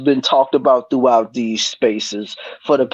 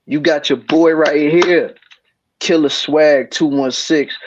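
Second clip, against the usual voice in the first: usual speaking voice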